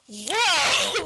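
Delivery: loud, high startled whoa. Retold or imitated. Fan reacting to shocking hallucination scene, loud startled whoa. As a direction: jolt back with a shocked yelp. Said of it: "and it's kinda just like".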